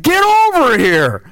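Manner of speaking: high, slow holler over distance; calling out. Retold or imitated. Hunter summons his buddy from across the woods, hollering. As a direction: stretch it out so he hears it far off.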